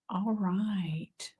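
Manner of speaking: a soft private whisper to yourself; whispered aside. Real, not acted. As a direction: breathe All right under your breath. Quiet aside while looking.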